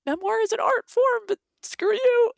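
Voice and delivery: shrill, high, loud mocking critic; hammed-up high, loud ranting critic. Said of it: "people will come after you and be like".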